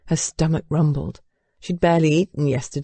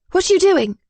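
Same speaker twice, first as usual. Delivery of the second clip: much higher, alarmed demand; 12 semitones higher, 4 dB louder than usual. A narrator's reading. Bride as husband heads for the door, high demanding. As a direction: demand an explanation at once. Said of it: "she demanded".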